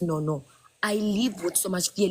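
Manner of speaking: ordinary talk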